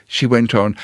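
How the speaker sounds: ordinary delivery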